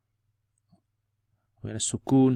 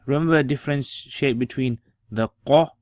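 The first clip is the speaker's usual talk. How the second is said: ordinary delivery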